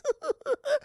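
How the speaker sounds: high manic chuckle; villain giggle. Acted. The Joker after Charlie's apology, giggling. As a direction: giggle with sinister glee.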